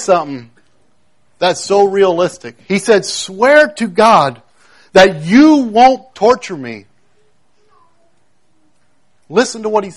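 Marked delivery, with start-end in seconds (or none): 3.0-6.9 slow, higher, louder imitated plea; retold crying out. Possessed man makes Jesus swear, retold crying out. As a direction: draw out the demand like a desperate cry. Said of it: "he's crying out saying".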